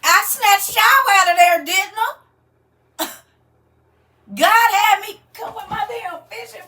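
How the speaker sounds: high, loud triumphant crowing; gleeful shout. Real, not acted. Host gloating to her live viewers, gleeful high shout. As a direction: crow over the viewers you caught.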